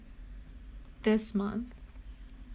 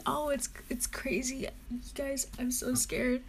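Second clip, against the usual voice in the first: whisper; 8 dB quieter than usual. Real, not acted. Excited vlogger whispering to viewers.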